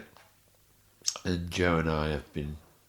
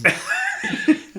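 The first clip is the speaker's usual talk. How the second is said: real high laugh at his own story; a bright laugh breaking out; high burst of laughter; 9 dB louder than usual. Real, not acted. Laughing at his hospital escape story.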